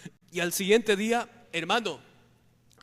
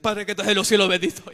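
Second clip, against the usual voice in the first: "Padre que estás en los cielos" burst out much louder, loudest on "cielos"; sudden loud prayer; 8 dB louder than usual. Retold or imitated. Brother crying out his prayer in fear as the possessed woman nears, loud. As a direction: cry the prayer out as fear hits.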